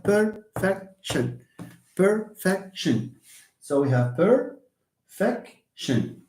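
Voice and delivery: perfection drawn out slowly, stress on -fec-; slow model word. Shown as a demonstration. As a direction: stretch perfection syllable by syllable. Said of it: "the word perfection".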